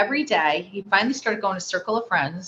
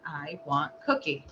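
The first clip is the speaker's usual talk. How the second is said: low, soft, incomplete phrase; toneless signed request; 4 semitones lower, 7 dB quieter than usual. Retold or imitated. Mother relaying her son's signed plea, low and soft. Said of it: "and he said".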